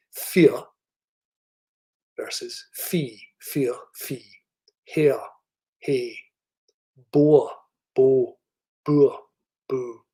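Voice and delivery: "fear" with a very weak R against "fee"; working-class Scottish minimal pairs, R barely there; vernacular Scottish accent. Shown as a demonstration. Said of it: "impersonated by me to show".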